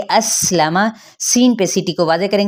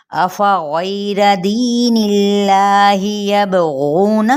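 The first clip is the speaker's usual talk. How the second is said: Quranic recitation; 2 semitones higher, much slower than usual. Shown as a demonstration. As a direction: sing out each word with held vowels. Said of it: "आगे हम जब पेट पलटेंगे तो फिर पढ़ेंगे".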